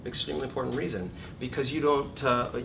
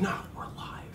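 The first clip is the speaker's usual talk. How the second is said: softer defiant whisper; joy voice whisper; 5 dB quieter than usual. Acted. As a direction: whisper it as a lifeline. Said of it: "the joy voice is going".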